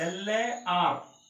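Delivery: slow, stretched "എൽ", "എ", "ആർ"; spelling out slowly. Shown as a demonstration. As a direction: spell "എൽ എ ആർ" letter by letter. Teacher spells large for learners. ("എൽ എ ആർ" ഓരോ അക്ഷരമായി പതുക്കെ)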